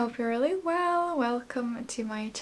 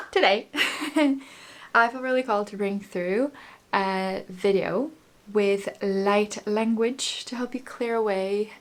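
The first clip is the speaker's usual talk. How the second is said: usual speaking voice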